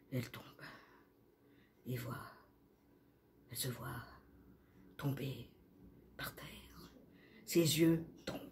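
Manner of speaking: very soft, halting, word by word; whispered narration. A narrator's reading. Narrator as La L falls to the ground, whispered. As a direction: barely breathe the words out.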